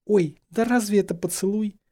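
high, waving-it-off exclamation; dismissive. A narrator's reading. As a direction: brush the idea aside. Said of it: "отмахнулась Гермиона". (высоко, отмахиваясь)

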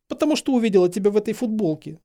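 high, quick whine; plaintive, hurried, high voice; whimpering. A narrator's reading. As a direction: whimper it out miserably. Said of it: "захныкала Гермиона".

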